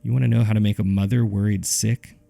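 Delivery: plain reading voice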